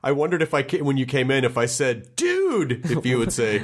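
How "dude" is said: high, excited cartoon-buddy call; cartoon greeting. Greeting a co-star as he came in, cartoon greeting.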